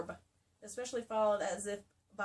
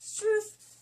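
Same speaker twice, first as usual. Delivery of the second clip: loud, high, over-the-top reading of "truth"; hammed-up line; 12 semitones higher, 9 dB louder than usual. Reading a romance aloud to a friend, hammed-up and loud. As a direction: ham up "truth" for laughs.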